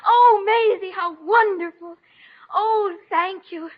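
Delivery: high, loud, gushing delight; overjoyed. Acted. Shy writer gushing thanks to Maisie for the photo. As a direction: squeal with grateful joy.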